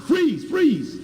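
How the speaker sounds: high-pitched, urgent command; urgent calling out. Real, not acted. Lecturer telling the tape operator to stop, urgent high call. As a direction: call out quickly to halt the tape.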